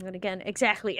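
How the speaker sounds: usual speaking voice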